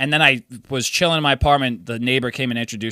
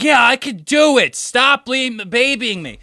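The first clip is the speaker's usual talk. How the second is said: mock drunk friend; 10 semitones higher, slower than usual. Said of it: "She's like".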